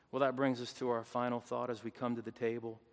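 ordinary talk

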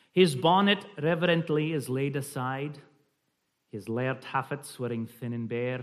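measured reading in light Scots; slight Scottish accent. Shown as a demonstration. Reading a Scots poem to the group, slight Scottish accent. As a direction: recite it with a Scottish lilt. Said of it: "a slight Scottish accent".

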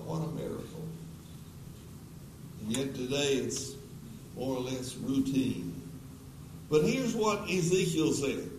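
ordinary talk, nothing marked

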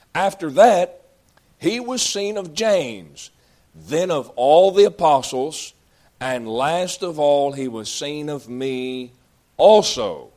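loud, slow, ringing scripture reading; building sermon climax, drawn out and loud; preaching at full force. Acted. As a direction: proclaim each phrase to the back pew.

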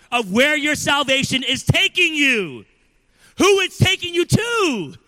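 loud, driving sermon voice; preaching at full force. Acted. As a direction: preach it hard to the congregation.

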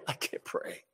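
unvoiced whisper of despair; hushed whispered confession; whispered plea. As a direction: whisper it, out of strength. Imitating desperate prayer.

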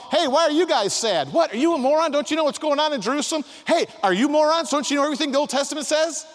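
high, loud, fast mock rebuke; mocking stranger. Stranger Jesus teasing sad disciples, high and loud. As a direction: needle them like a brash stranger. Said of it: "This is some stranger".

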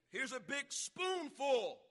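high, loud, drawn-out announcement; slow, hammed-up call with the spoon; imitated mother dosing. Acts mother dosing castor oil.

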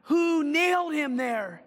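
a witness raging at the crucifixion, a furious cry; furious demand. Retold or imitated. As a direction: demand it in outrage at the cross. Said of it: "And he kept asking".

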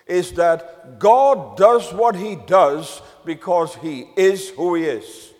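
ordinary talk, nothing marked

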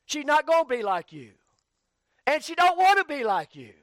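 high, loud, rising pulpit preaching; full-force preaching. Acted. A preacher urging husbands to love their wives, full-force preaching. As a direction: drive it home to the congregation.

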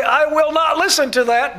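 loud, high, mimicked defiance; hammed-up obstinate protest; mock stubborn refusal. Mimics people refusing to hear.

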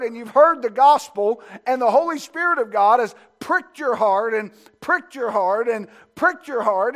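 rising preacher's build with repeats; preaching. Acted. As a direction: press it home to the congregation.

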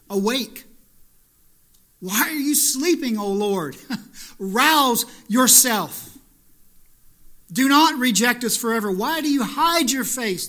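loud, high pleading psalm; pleading cry. Retold or imitated. Psalmist crying out to a silent God, loud plea. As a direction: call on God to wake up. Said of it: "We read in our scripture reading".

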